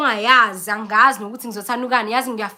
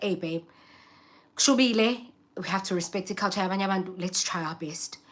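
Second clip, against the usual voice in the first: softer, gentle husband urging; husband's soft coaxing; 3 semitones lower, 6 dB quieter than usual. Retold or imitated. Husband quietly coaxing his wife to eat. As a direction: coax your wife quietly. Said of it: "he was just like".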